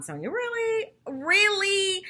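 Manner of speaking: high, drawn-out "really?"; exasperated disbelief. Retold or imitated. Host scolding Sonia's excuse, high and exasperated. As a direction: sound fed up and incredulous. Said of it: "I was like".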